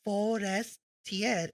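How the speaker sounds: Forestier sounded out very slowly; slow, deliberate Forestier; careful pronunciation demo. Shown as a demonstration. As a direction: stretch Forestier out in separate parts. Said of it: "Pronounced as".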